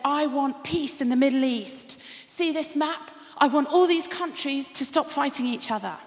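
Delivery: pleading loudly to the genie; excited woman. Acted. Woman demands peace in joke.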